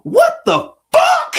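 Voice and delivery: high, loud, slow yell through laughter; loudest voice. Retold or imitated. Little girl yelling it in the bookstore, loud and high. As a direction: blurt it out as loud as a kid can. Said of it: "in the loudest voice she could muster up".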